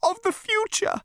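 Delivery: very high, pitiful elevator voice; miserable. A narrator's reading. Elevator confessing its fear, miserable and high. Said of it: "said the elevator miserably".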